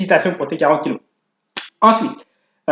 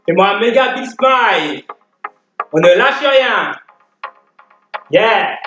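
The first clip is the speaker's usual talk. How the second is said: barking gym coach; 5 semitones higher than usual. Acted. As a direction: pump the class up like a fitness coach. Leads workout over music.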